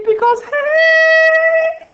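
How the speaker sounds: very high, loud, drawn-out hey; squeaky falsetto shout. Pretend. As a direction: stretch it into a goofy high yell. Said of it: "talk like this".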